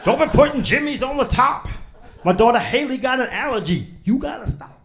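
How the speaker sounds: mock [name]'s furious ranting. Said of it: "Every song is like".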